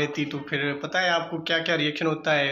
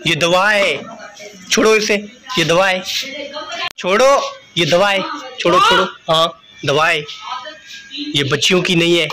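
stern scolding; 6 semitones higher, 9 dB louder than usual. Real, not acted. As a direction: snap at her to drop the medicine now. Father scolds child over medicine.

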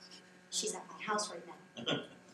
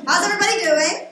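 louder, higher crowd warm-up; rousing crowd greeting; 6 semitones higher, 10 dB louder than usual. Shown as a demonstration. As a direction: belt it out to get the room going. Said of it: "Let's try that again".

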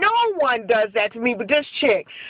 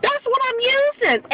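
much higher, insistent "That's what I'm using"; pitched-up exasperated repeat; exasperated insistence; 9 semitones higher than usual. Real, not acted. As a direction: throw up your hands and repeat it.

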